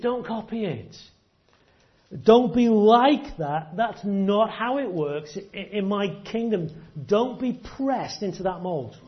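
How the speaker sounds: earnest warning as Jesus. Acted. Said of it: "Jesus is saying to them".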